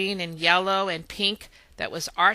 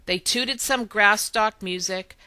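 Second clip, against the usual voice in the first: plain reading voice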